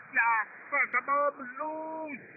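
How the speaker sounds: mock German submarine officer with a German accent; fake German accent. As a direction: ham up a movie-style German accent like in Das Boot. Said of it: "he loved to talk in his fake German accent".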